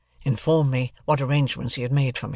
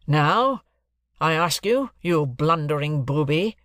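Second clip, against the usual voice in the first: very stern; 2 semitones higher, slower than usual. Acted. Guardian confronts the blundering client, very sternly. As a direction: demand sternly, slowing on the insult. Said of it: "very sternly".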